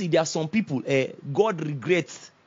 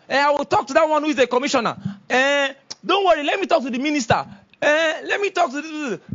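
mock self-important phone-around, higher pitched; name-dropping big man; 8 semitones higher than usual. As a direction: imitate someone eagerly dropping officials' titles.

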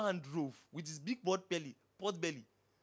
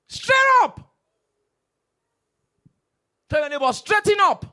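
high, very loud cry; full preaching shout; 11 semitones higher, 18 dB louder than usual. Real, not acted. Preacher rousing the congregation, very loud shout. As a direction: blast it to the hall.

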